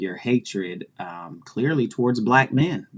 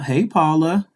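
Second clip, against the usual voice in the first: normal talking voice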